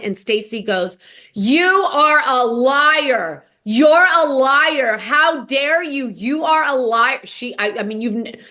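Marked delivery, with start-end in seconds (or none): 1.3-7.2 slow, loud accusing outburst, a bit higher; furious accusation. Retold or imitated. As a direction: spit out each word like a charge of betrayal. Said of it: "she made more noise in this one interaction".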